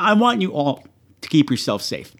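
ordinary delivery

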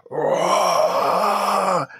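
big raging roar; roaring battle cry. Acted. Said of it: "holds his great axe up in the air and goes".

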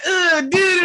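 put-on whiny grossed-out kid; mock kid disgust; childish disgust.

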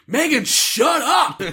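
high, slow exasperated mock shout; mock yelling. Imitating Josh silencing Megan, mock yelling. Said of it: "yelling at Megan".

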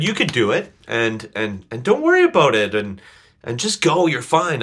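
high, eager cheerleading encouragement; encouraging upbeat voice. Retold or imitated. Voicing encouraging Cindy.

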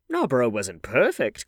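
slow, loud, emphatic objection; mildly indignant old lady; crying out. A narrator's reading. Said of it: "cried the old lady".

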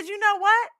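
high, loud angry tirade; furious rant. Retold or imitated. Telling off the Apple agent, a loud furious rant. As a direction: let her have it. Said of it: "then I lost it".

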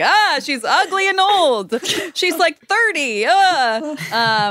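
high, loud horrified cry of disgust; mock disgusted shriek. Domenico recoils at the bride's face, high mock-horrified shriek. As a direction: squeal in mock horror at her face. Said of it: "sees her face and is like".

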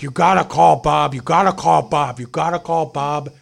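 mock a loud, repetitive demand, strongest at the start; retold yelling. Man in the chair badgering a stranger, imitated yelling. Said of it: "yelling at me".

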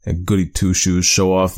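ordinary delivery

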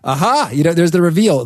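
a touch of triumphant discovery; retold delight. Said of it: "laugh out loud in delight".

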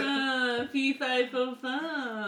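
mock accented giant chant. Shown as a demonstration. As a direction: try the giant rhyme with a put-on accent. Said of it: "Could you give every character an accent?".

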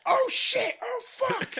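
very high comic alarm; mock panic. Lucky dads attacked as credits roll, mock panic. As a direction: squeal it as a sudden fake freak-out.

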